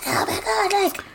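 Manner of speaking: high, breathy-free panicked gasp of a line; mock panic. Megan on the phone in a high mock panic. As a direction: squeal it in mock alarm.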